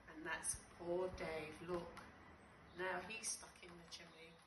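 usual speaking voice

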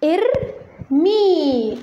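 ارمي stretched very slowly; slow model word. Shown as a demonstration. Teacher sounding out the root for students, very slow. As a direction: hold each sound of ارمي. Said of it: "أصلها".

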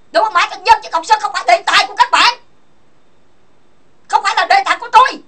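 heated tirade at rivals on the mic; angry outburst. Real, not acted. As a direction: snap it out in real fury. Heated livestream rant at rivals.

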